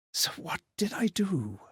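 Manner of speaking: breathy unvoiced whisper to himself; hushed, secretive self-question. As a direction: whisper the question as if afraid to know. Ghost wondering about own past.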